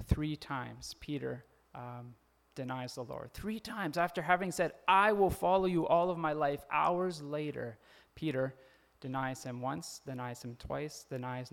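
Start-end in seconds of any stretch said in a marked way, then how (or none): 4.9-6.6 loud, emphatic pledge; bold vow. Acted. Peter pledging to follow Jesus, loud and emphatic. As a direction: declare it grandly, with total confidence.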